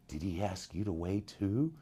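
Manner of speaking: lightly imitating a fellow auditionee; hushed aside. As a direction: ask it quietly, as a private aside.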